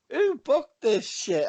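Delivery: high, slow mock-appalled question; incredulous disbelief. Co-host repeating his reaction to the show, incredulous.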